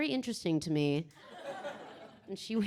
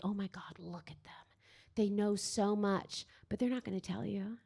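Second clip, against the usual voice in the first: awed whisper; 3 semitones lower, 7 dB quieter than usual. Retold or imitated. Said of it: "you're like".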